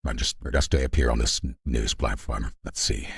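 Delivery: ordinary delivery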